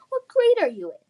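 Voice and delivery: high, loud sneering question; high mocking taunt. Bully belittling Lily, high loud sneer.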